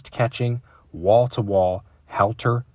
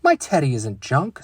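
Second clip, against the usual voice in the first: mild mock scream; 6 semitones higher, 5 dB louder than usual. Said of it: "screamed sister".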